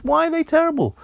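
put-on high, puzzled child voice; little boy Roger. A narrator's reading. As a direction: ask like a small boy. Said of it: "Roger said".

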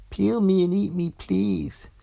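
an orange begging to be eaten; pleading orange. A narrator's reading. An orange begging the girl to eat it, high.

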